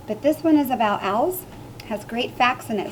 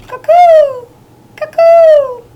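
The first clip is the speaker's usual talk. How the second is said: loud, high, hooting cuckoo call; 17 dB louder, much slower than usual. A narrator's reading. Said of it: "The cuckoo called".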